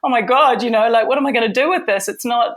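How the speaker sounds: a hammed-up rush of worry; replaying her own panic about the tear; mock panic. Panic over a torn wig.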